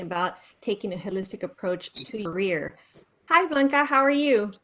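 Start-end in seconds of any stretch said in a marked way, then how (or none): none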